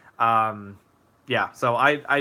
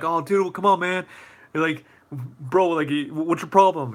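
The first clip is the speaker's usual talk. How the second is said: higher-pitched mock bully goading; goading challenge; 4 semitones higher than usual. Imagined adult trying to start a fight, mock goading. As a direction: needle him like someone itching for a fight.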